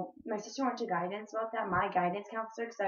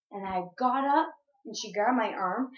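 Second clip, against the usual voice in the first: ordinary talk, nothing marked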